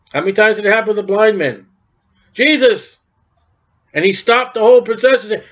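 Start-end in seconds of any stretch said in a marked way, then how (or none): 2.3-3.0 pitched-up call for Jesus. Acted. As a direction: cry out his name to stop him. Blind men calling out to Jesus.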